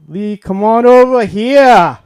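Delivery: loud, slow Boston bellow; father's Boston voice. Retold or imitated. Father calling his son across the house, loud Boston yell. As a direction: call the kid over in thick Boston. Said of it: "his voice would sound like this".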